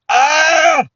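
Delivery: high, loud "ah"; delighted yell. Acted. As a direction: cry out as if overcome by joy. Exclaims over being called sweetie.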